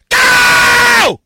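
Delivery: very high, explosive yell; shouted start signal. Real, not acted. Starting Perry's sixty seconds, explosive shout. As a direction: scream it like a starting gun. Launching a timed segment.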